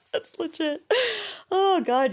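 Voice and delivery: high burst of laughter; laughing hard. Real, not acted. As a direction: crack up at your own story. Laughing at her own story.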